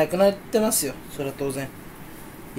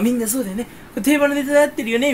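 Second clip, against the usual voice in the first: imitated check for agreement, pitched up; 確認するように; 6 semitones higher, faster than usual. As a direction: seek agreement on a running gag. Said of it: "みたいな、その確認の顔". (高めの声で同意を求める感じ)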